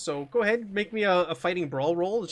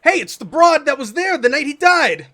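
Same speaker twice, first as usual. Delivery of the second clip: reporters calling out; 9 semitones higher, 8 dB louder than usual. Acted. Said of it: "They go".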